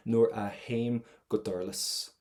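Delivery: "haim" slowly, starting on H, not T; measured model reading with the T softened away in "haim"; no T sound. Shown as a demonstration. Teacher repeats the line for students, no T in "haim". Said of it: "I should not hear that T sound".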